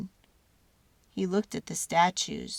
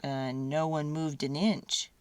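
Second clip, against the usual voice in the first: usual speaking voice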